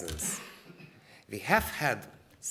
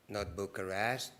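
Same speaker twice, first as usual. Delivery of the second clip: normal talking voice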